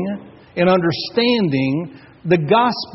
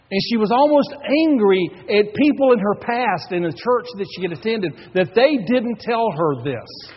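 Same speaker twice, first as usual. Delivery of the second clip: normal talking voice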